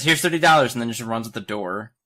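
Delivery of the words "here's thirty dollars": louder, higher tossed-off offer; loud blurt. Retold or imitated. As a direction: throw the line out loud.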